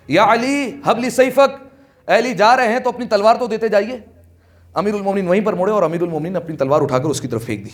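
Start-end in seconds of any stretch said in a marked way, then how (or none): 0.1-4.2 calling out. Retold or imitated. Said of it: "اس نے آواز دے کر کہا".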